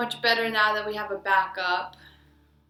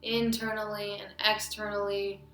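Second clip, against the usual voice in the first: guided meditation. Acted. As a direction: lead them inward, phrase by phrase with long pauses.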